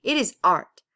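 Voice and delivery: firm ruling. A narrator's reading. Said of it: "decided the court".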